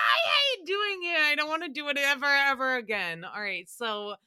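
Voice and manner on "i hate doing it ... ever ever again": loud, high protest; exasperated outburst. Real, not acted. Host hating her own moan demo, loud embarrassed protest. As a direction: burst out complaining, coming down gradually. Recoils after redoing the moan.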